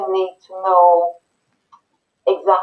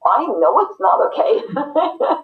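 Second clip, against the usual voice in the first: defensive retort; 8 semitones higher, slower than usual. Pretend. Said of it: "you would like".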